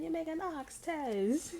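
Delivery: slow, high mock Jamaican accent. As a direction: imitate a Jamaican man, drawn out and high. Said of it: "Them Jamaican, he's like".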